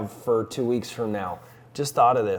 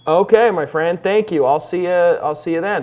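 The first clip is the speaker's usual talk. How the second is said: raised, higher phone voice; loud phone voice; 5 semitones higher, 9 dB louder than usual. Real, not acted. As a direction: project up for a weak line.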